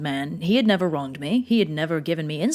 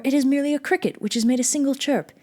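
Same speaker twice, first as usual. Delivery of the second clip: hurried self-reassurance; 6 semitones higher, faster than usual. Acted. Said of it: "saying to himself".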